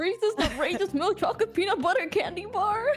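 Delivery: put-on whiny kid voice. Kid begging dad for candy.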